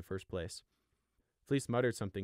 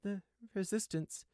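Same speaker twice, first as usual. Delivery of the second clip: dazed realization; 11 semitones higher than usual. A narrator's reading. Sheep slowly recognizes masked figures.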